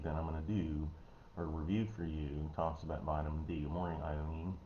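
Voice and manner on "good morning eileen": normal talking voice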